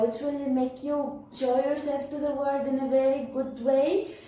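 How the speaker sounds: ordinary talk, nothing marked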